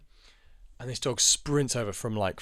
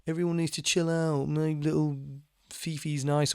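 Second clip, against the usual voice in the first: higher, sing-song mock of a dog owner; 5 semitones higher than usual. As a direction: parody the owner who plays innocent, pitched up. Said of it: "they pretend to feel discriminated against".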